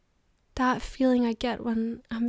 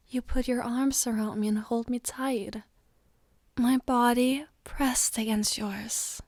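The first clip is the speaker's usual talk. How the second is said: whispering. Acted. Intimate memory told to partner.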